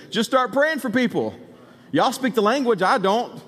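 high, pressing call to a team; retold urgent instruction. Preacher retells directing his trainees in the swarm, urgently.

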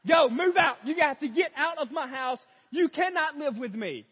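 loud, high ranting yell; frantic shout. Retold or imitated. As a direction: shout it out, totally losing it. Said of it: "And then I go".

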